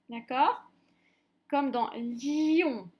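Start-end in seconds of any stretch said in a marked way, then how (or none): 1.9-2.9 "lion" slowly, the i stretched before "on"; prolonged I. Shown as a demonstration. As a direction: draw out the i in "lion" on a breath. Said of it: "vraiment prolonger notre I". (« lion » avec le i soufflé, tiré en longueur)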